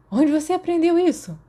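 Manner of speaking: high loud breathless question; gasping, exultant. Acted. Sirius thrilled after landing the punch, gasping. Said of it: "Sirius ofegou, exultante".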